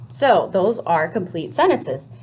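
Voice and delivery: ordinary talk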